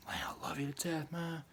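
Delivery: mock whisper of a fake-friendly buddy. Buddy who's robbing him, mock whisper. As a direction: breathe it like a sly pal sucking up.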